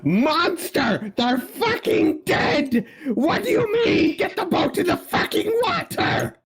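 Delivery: very high, frantic shouting; high-pitched, stretched-out alarm cries; panicked yelling. Acted. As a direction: scream orders in a panic. Player acts panicked cry to crew.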